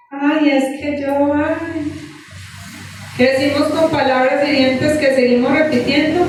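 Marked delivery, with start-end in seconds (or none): 0.1-1.9 slow, put-on poor-me sighing; mock self-pity. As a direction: act pitiful, trailing off softly. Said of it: "siendo lastimositos".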